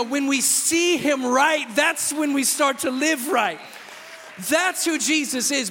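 building declaration. Acted. Pastor proclaiming Jesus to the church, rising loud. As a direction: build to a triumphant cry.

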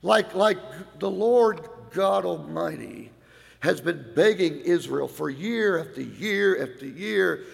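usual speaking voice

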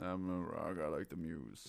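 deep, quiet gravelly Rock voice; low, soft tough-guy growl; talking rock. Retold or imitated. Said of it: "Why does my rock impression".

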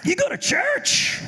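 very high, slow incredulous shout; teen stunned that his stoner friend goes to church; shocked disbelief. Retold or imitated. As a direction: squeal it in total disbelief. Disbelief at stoned buddy's invitation.

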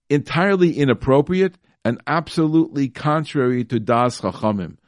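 entirely inappropriate leaned on hard; emphatic repeat. Shown as a demonstration. Rabbi rereads the ruling, pressing each word. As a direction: drive home entirely inappropriate with weight.

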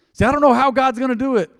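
loud, high-pitched mock protest; voicing a doubter. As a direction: blurt it out as a worrier.

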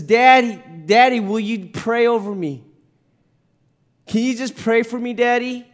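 put-on high, loud plea of a hurting child; pleading son. Son in pain asks his father to pray, pleading. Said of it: "my son says".

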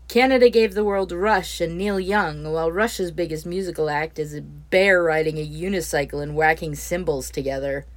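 loud, indignant defence. Acted. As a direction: declare it proudly and loud. Neil defends Canada heatedly.

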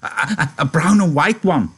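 high, loud, stammering eagerness; excited cry. A narrator's reading. Lenny begging George for a pup, crying out excitedly. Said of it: "Lenny cried excitedly".

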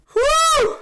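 high, loud release of breath; loud whoop of relief. Real, not acted. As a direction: let it all out in a whoop. Relief after finishing podcast.

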